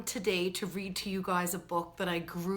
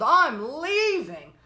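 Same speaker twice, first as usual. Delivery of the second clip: put-on higher, louder shout of a fed-up kid; child's yell; 7 semitones higher, 8 dB louder, slower than usual. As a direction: yell it out, leaving home. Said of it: "I yelled".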